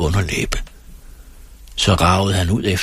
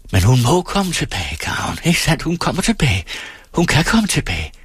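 high, urgent insistence; desperate pleading; 9 semitones higher than usual. A narrator's reading. Singer begs ferryman to allow her return, high and desperate. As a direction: plead in a high, straining pitch.

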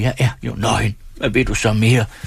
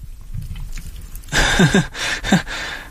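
long high hysterical giggling; drawn-out helpless laughing fit; uncontrollable laughter; 8 semitones higher, 3 dB quieter than usual. A narrator's reading. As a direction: keep laughing, unable to stop. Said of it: "Jeg må bare hele tiden le".

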